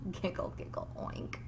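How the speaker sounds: hushed, unvoiced giggle giggle; soft giggle. Teacher whispering the pigs' sneaky giggles.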